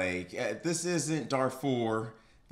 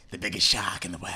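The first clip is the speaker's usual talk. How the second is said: put-on Australian accent; 6 semitones lower than usual. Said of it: "some sort of Australian accent".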